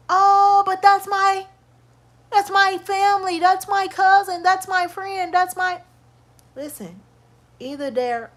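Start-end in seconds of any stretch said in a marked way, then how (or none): 0.1-5.8 lightly voiced whiny excuse-maker, higher; mock excuse voice. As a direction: toss off their excuses in a put-on higher voice. Mocking friends' excuses for staying.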